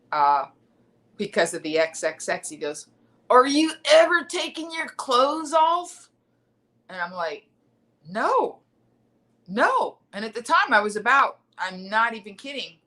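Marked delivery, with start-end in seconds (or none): 3.3-6.1 high, loud drawn-out mock heckle; slowish, raised-pitch drunk taunt; drunk heckler. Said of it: "the town drunk". 8.1-10.0 high-pitched mock-offended retort; retold indignant refusal. Retelling her answer to heckler.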